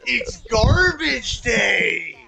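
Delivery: yelling it out through laughter; big gleeful shout, drawn out slowly; excited laughing shout. Real, not acted.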